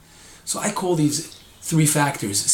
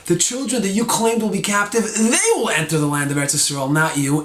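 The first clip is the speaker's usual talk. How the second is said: pointed, louder rebuke; criticism; 5 semitones higher, 4 dB louder than usual. Retold or imitated. God rebuking the people over their children, critical. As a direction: press the criticism home, firmly. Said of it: "that before was a criticism".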